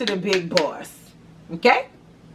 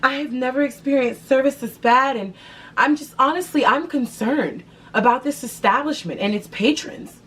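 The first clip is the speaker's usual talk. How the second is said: higher, prim, offended customer voice; concerned complainer; 6 semitones higher than usual. Pretend. To the staff, a higher, prim, offended customer. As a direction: act deeply concerned and wounded. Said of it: "literally just".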